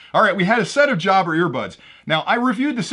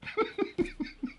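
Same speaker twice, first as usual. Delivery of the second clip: breathy giggle; 12 dB quieter than usual. Real, not acted. Laughs at own joke.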